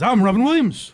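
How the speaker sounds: loud, laughing Robin Williams voice; a hammed-up Robin Williams; Robin Williams impression. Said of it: "no better impression than".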